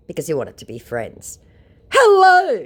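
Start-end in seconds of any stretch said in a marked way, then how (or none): 1.9-2.6 high, loud, sing-song hello; put-on cheery hello; mock sweet scammer hello. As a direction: greet like a fake celebrity. Scammer's greeting read out.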